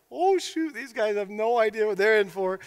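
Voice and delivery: high-starting aside, then settling; knowing alarm. Retold or imitated. Bartender watching thugs pick on a champion, knowing alarm. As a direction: play a bartender who sees trouble coming.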